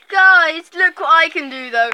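loud eager look-at-this; excited showing off. Pretend. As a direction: hype it up for viewers. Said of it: "You should be like".

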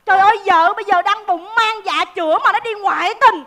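loud, aghast outburst; outraged shock. Acted. Café seller hears of the affair, outraged. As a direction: exclaim in indignant disbelief.

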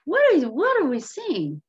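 high, loud acted disbelief; astonished onlooker. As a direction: gawk aloud in amazement. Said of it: "The townsfolk say".

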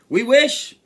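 high, loud fish voice; emphatic high-pitched wish. A narrator's reading. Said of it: "said the fish".

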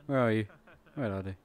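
ordinary talk

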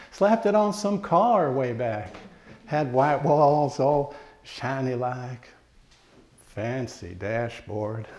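put-on homespun country accent, unhurried and reflective. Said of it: "I do it with a country accent".

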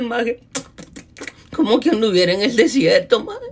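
normal talking voice

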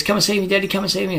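put-on high pleading little boy voice; pestering child. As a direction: beg like a small child. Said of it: "And he goes".

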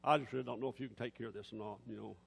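low, subdued doubting mumble; half-hearted, low-pitched admission of doubt; voice of unbelief. Acted. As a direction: doubt it softly, quickly, low in the voice. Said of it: "That's what unbelief is".

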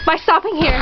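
ordinary talk

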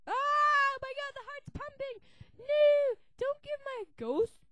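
high, loud startled cry; alarmed. Acted. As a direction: panic at the pumping heart. Heart starts pumping.

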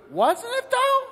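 very high-pitched questioning; skeptical angel. Acted. Angel challenging the man's protest, high-pitched disbelief. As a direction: push the doubt up into a squeak.

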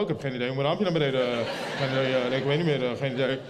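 low, fumbling play-dumb voice; feigned cluelessness. Acted. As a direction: stumble through it low, playing clueless. Feigns ignorance through the car window.

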